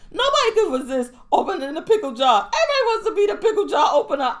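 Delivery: rattled off fast and laughing; fast quote. Retold or imitated. Friend retelling Larry's pickle jar rant, fast and laughing. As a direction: rush the lines out through giggles. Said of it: "he's like".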